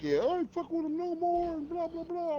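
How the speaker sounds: high-pitched imitation of an upset friend; mock complaint. Friend retelling who quit on whom, high mock complaining. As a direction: retell his griping way up high.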